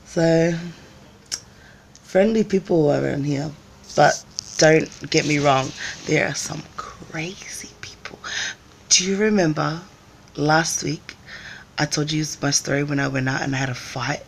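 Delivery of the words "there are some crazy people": hushed secret whisper; whispered aside. Real, not acted. Warning viewers about crazy people, whispered. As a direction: lean in and whisper it.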